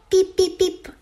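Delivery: very high, loud peeps; chirping newborn ducklings. A narrator's reading. Newly hatched ducklings exclaiming together, high chirping. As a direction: cheep out in a high little voice. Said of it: "esclamarono i nuovi nati".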